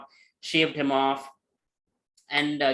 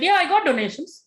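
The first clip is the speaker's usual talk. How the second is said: high, loud, eager protest; eager insistence; 13 semitones higher, 6 dB louder than usual. Retold or imitated. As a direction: burst out high and loud to defend yourself. Peter insisting he got donations.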